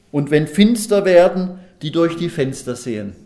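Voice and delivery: verse proclaimed loud and high, then settling; emphatic public Bible reading; scripture reading. Acted. Reads out the next verse.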